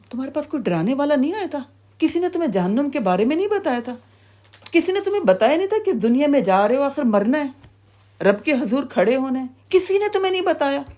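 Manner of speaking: high-pitched incredulous questioning; interrogating gatekeeper. Acted. Keeper of Hell asking if nobody warned them, incredulous interrogation. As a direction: press each question harder, pitch climbing. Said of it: "پھر وہ کیا کہے گا؟".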